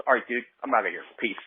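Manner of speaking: normal talking voice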